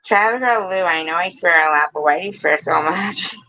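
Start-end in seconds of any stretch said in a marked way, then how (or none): none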